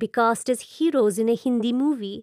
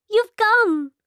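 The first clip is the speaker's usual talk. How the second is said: high, bright cry of joy; excited; 10 semitones higher, 4 dB louder than usual. Acted. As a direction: burst out in delight. Meeta greets lover excitedly.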